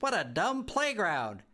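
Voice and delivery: put-on a cheeky little girl laughing at the house; giggly bratty girl. A narrator's reading. Girl giggling at her new home, high and cheeky. Bea continues shouting.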